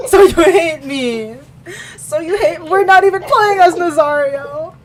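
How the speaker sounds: high, very loud playful wail; mock outrage. Reader accusing co-reader of spoiling the scene, mock outrage. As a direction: shriek the accusation, half laughing.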